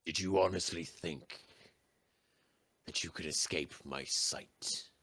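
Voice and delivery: slow, looming villain voice; menacing echoing presence; unearthly voice. Acted. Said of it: "you hear an echoing voice".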